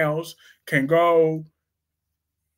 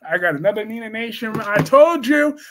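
loud gloating, higher and louder; triumphant; 3 semitones higher, 6 dB louder than usual. Real, not acted. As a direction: crow about the win, told-you-so. Gloating over a new member.

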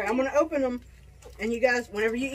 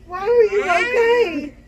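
laughing; 9 semitones higher, 6 dB louder, slower than usual. Real, not acted. Laughing through sour candy.

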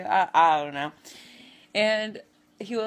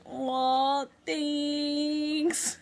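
high, cooing "aw"; touched thanks; 8 semitones higher than usual. Retold or imitated. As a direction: gush it sweetly in a raised pitch. Retold touched reply to compliment.

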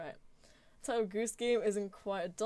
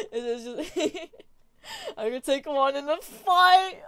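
slow, louder, laughing through the boast; 8 semitones higher, 6 dB louder than usual. Real, not acted. Streamer boasting she'd beat a goose, laughing. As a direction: laugh as you brag.

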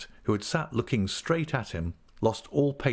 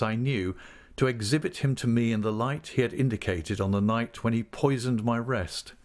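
usual speaking voice

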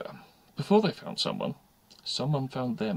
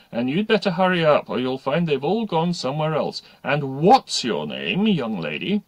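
loud schoolteacher giving orders; raised, commanding teacher voice; stern teacher; 5 semitones higher, 8 dB louder than usual. Acted. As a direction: hurry the pupils along with authority. Stern teacher sends pupils off.

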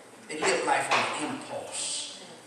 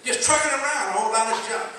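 louder, higher repeat of "trucking around"; emphatic repeat; 6 semitones higher, 6 dB louder, faster than usual. Shown as a demonstration. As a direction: punch it again for the church. Preacher repeats line for emphasis.

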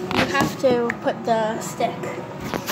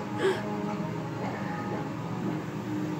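sharp nervous gasp; 6 semitones higher, 10 dB quieter than usual. Real, not acted. Popsicle pour nearly spills.

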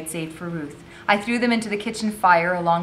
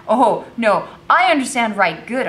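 louder, higher defiant retort; heated counter; 5 semitones higher, 8 dB louder than usual. A narrator's reading. Girl countering the boy's protest, defiant. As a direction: counter firmly and hotly. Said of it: "I countered".

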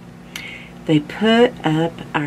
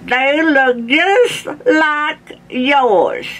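put-on high, slow old-man accusation; drawn-out, hammed-up suspicion; suspicious growl; 9 semitones higher, 3 dB louder, much slower than usual. A narrator's reading. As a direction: accuse slowly in a toothless Grandpa voice. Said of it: "growled suspiciously".

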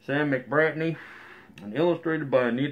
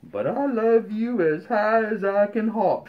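lightly higher, smiling big hare; 8 semitones higher, 3 dB louder than usual. Acted. Big hare tops little hare, smiling. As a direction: say it with a smile. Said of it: "smiled Big Nut Brown Hare".

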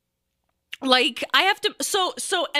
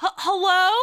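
higher, tentative greeting to a dog; mimicked hesitant caller with raised pitch; visitor calling out; 5 semitones higher than usual. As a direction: call hello into the house, questioning. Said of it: "she's still there going".

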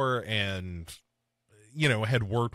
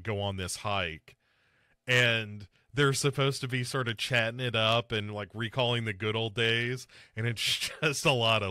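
ordinary talk

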